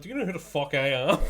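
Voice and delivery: mock indignant. Imagined retort at till.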